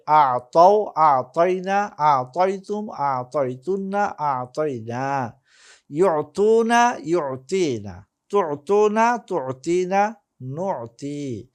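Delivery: measured drill with drawn-out long vowels; slow, lilting conjugation drill; model recitation. Shown as a demonstration. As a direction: recite each form for students to copy. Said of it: "আমি একবার করে উচ্চারণ করে দিব".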